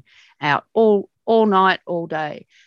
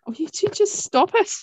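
high mock-exasperated mum voice, much higher. Mum telling husband and daughter to stop it, high mock-exasperated. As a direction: squeak it out like a fed-up mother. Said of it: "she'd just be there going".

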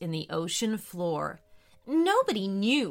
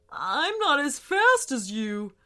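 mildly put-out whale; gentle complaining giant; grumbling whale; 4 semitones higher than usual. A narrator's reading.

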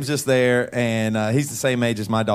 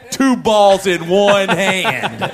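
loud, high, stretched-out proud announcement; mock-excited, drag out each word triumphantly; excited guy boast; 6 semitones higher, 6 dB louder, much slower than usual. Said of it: "And he's that excited".

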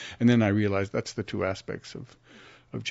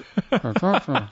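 laugh; 8 semitones higher, 4 dB louder than usual. Real, not acted. Laughing at frozen mouth story.

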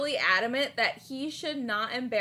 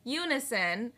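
ordinary delivery

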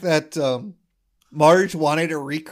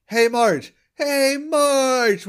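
mock Homer bellow; 8 semitones higher, much slower than usual. Homer calling Marge like the famous play, mock bellow. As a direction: howl it up at the window, hammed-up. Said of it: "he does the".

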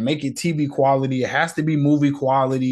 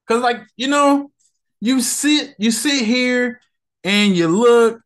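getting mad; 9 semitones higher, 3 dB louder, slower than usual. Real, not acted. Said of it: "I'm getting irritated. Getting mad".

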